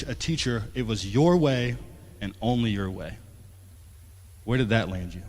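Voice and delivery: ordinary talk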